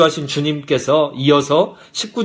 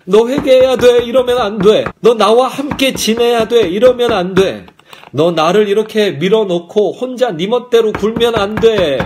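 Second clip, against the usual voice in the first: raised, high-pitched insistent scolding; urgent admonishing; 5 semitones higher, 4 dB louder than usual. Acted. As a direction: plead loudly as if through a shut door. Voices the Lord knocking, urging repentance.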